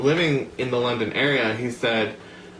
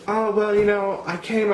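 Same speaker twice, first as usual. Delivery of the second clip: a rough, hammed-up UK accent, pitched higher; lightly mock a Londoner in a British accent; trashy UK accent; 8 semitones higher than usual. Imitating the man from London in a trashy UK accent. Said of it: "slipping into a really trashy version of, like, a U K accent".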